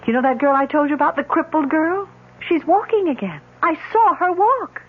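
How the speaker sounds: awed excitement at a miracle; excited. Acted. Ida tells of the cure.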